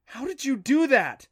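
loud, high-pitched amazement; astonished outburst. Acted. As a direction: blurt it out in disbelief. Boy astonished at the escape.

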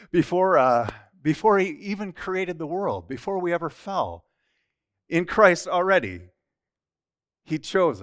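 real emotion pushing the voice up; getting emotional. Real, not acted. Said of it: "Sorry, I'm getting emotional".

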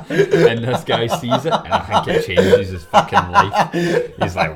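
high, slow words through laughter; laughing. Real, not acted. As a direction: tell it while cracking up. Narrating while laughing.

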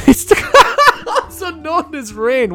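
high, slow, giggling through the words; can't stop laughing while reading. Real, not acted. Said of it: "What a dick".

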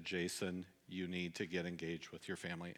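low, hushed nudge from within; little inner voice. Retold or imitated. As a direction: drop soft and low, like a thought urging you. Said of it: "that little voice in the back of my head".

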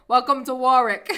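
badly faked Rhode Island greeting; attempted Rhode Island accent. Shown as a demonstration. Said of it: "I can't do it either".

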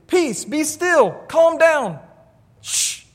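Jesus stilling the storm; commanding the storm. Retold or imitated. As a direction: speak to the storm with authority. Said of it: "He literally talks to a storm".

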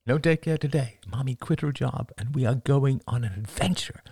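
father pitching an adventure to his son, upbeat; upbeat persuasion. Acted. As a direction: make it sound like a treat. Father selling trip brightly.

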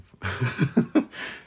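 breathy high little laugh; quick self-conscious laugh; short laugh. Real, not acted. Laughs at own remark.

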